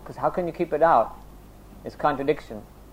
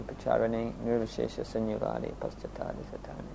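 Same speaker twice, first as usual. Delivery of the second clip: hushed devotional chant, dropping softer to the end; Sanskrit prayer recitation; 6 dB quieter than usual. Real, not acted. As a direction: recite it quietly on a level pitch, trailing away.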